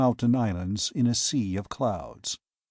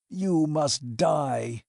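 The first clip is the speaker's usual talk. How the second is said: slow, dragged-out, dread-laden menace; grim slow threat; awful voice; 3 semitones higher, much slower than usual. A narrator's reading. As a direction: let each word fall like a sentence of doom. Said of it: "said Maskell in an awful voice".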